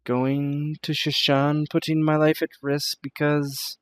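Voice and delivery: lightly voiced, formal character with misgivings; deliberate, hesitant delivery that slows toward the end; doubtful Fatima. Fatima doubting a traveler's promise of safety, hesitant.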